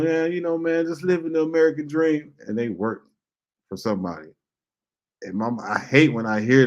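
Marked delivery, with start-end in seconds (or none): 0.0-2.3 a lightly voiced, higher smug guy; mock it, pitched up and pleased with himself; imitating a smug dude. To the speaker at the smoke shop, a lightly voiced higher smug dude. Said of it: "you tell somebody".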